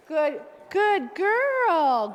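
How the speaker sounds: slow, drawn-out high praise; praising a scared dog. Real, not acted. Trainer to the scared little dog, slow high praise.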